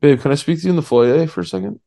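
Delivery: put-on smug boyfriend talk, hurried; mock douchey fiance. Pulling the girlfriend aside mid-show, smug and fast. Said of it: "You sound like such a douchebag".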